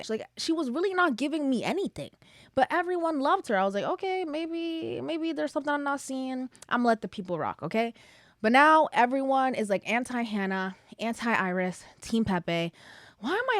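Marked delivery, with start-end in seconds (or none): 3.8-7.9 grudging concession. Retold or imitated. Quoting her own hesitant concession.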